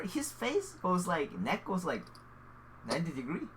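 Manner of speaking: ordinary talk, nothing marked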